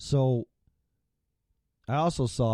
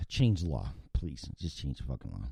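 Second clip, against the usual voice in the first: much softer, lower, worn-out mutter; exasperated mutter; 8 semitones lower, 5 dB quieter than usual. Real, not acted. Fed-up vlogger begs lawmakers to change the law, soft exasperated mutter. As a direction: mutter it under your breath, fed up.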